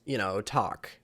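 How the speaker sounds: ordinary talk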